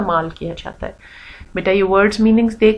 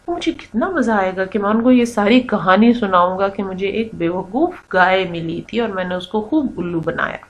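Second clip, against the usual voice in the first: usual speaking voice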